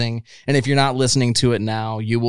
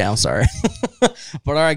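ordinary talk

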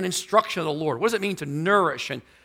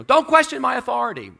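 high, loud scolding; angry parent yelling; 9 semitones higher, 5 dB louder than usual. Retold or imitated. Parent yelling at child who talks back, loud scolding. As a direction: bark it down at a defiant kid. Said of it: "you're yelling at them".

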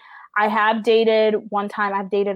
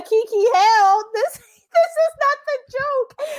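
much higher, laughing and near-tears, slowed; laugh-crying retelling; 3 dB louder than usual. Retold or imitated. Retelling the pregnancy test shock, laughing through tears. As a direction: squeal it out half laughing, half crying. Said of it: "I cried".

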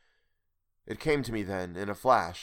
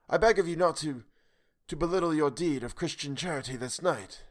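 higher, grave and sorrowful stranger; higher, solemn voice out of the night; the strange voice; 7 semitones higher than usual. Acted.

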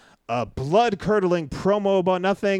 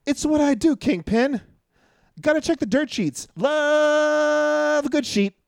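goofy high voice; 8 semitones higher than usual. Pretend. Goofy hyped voice for dirt sheets.

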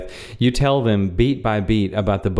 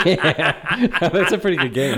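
higher-pitched, laughing approval; real laughter; 7 semitones higher, slower than usual. Real, not acted. Friend approving the window prank, laughing. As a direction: talk through a real laugh.

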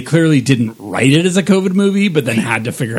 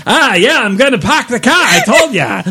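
bad Boston accent; 9 semitones higher than usual. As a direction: ham up Boston, park the kah. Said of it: "deeply terrible".

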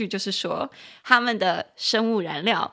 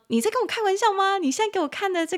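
你在跟我开玩笑吗 asked higher, in mock disbelief; 11 semitones higher, faster than usual. Teacher acting out teasing a friend, mock disbelief. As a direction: pretend to doubt your friend's claim. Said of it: "跟他讲说".

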